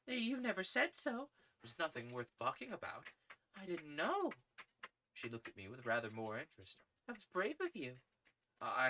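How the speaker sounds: a lady warming to his bravery; Gladys surprised. A narrator's reading. Gladys surprised, then approving.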